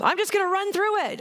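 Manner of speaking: high-pitched, hammed-up recklessness; mock bravado. Speaker imitating a grunt facing a minefield alone, mock bravado. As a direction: play the foolhardy go-it-alone type.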